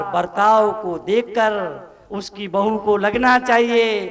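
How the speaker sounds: ordinary delivery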